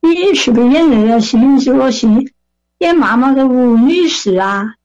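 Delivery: imitated Sichuanese voice; Sichuan dialect. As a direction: ask as a little Sichuan granny. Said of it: "用四川话问我说".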